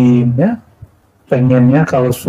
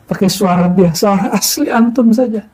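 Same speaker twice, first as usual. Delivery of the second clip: usual speaking voice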